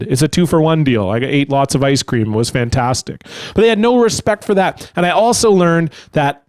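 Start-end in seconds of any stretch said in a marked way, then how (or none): none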